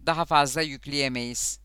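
usual speaking voice

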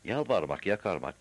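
plain reading voice

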